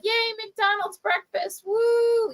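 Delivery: high sing-song yay, naive; clueless cheer. Retold or imitated. Kid innocently cheering about breakfast. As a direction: chirp it like a happy oblivious kid. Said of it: "I don't know any of this".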